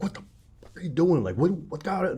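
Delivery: mock yelling. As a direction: act out an angry father's outburst, half-voiced. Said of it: "he's, like, yelling at her".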